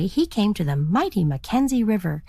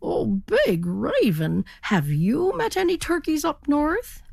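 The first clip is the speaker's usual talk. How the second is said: inquiring turkey; 7 semitones higher than usual. A narrator's reading. Turkey hails the big raven, rising high. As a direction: stretch the name, lift the question.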